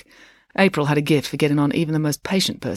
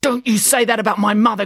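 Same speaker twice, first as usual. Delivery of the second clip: louder, raised-pitch warning; angry growl; 6 semitones higher, 5 dB louder than usual. A narrator's reading. Finn growls at Kiran about his mother. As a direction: growl it out in anger. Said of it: "growled Finn".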